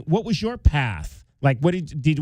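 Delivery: normal talking voice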